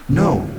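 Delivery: a sudden, forceful "No"; extraordinary emphasis. A narrator's reading. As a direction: refuse emphatically on "No". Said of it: "said the voice with extraordinary emphasis".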